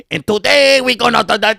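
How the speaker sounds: lightly mock a Mexican singer's accent. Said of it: "imitate a Mexican accent".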